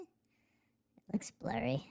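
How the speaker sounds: unvoiced muttered whisper. Real, not acted. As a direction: whisper it to yourself. Whispered aside.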